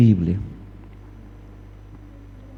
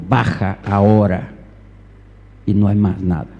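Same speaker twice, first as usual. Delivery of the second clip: stern angry father; 6 semitones lower than usual. Retold or imitated. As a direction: give a firm, controlled order as an angry dad. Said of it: "una cara de bravo, de enojado".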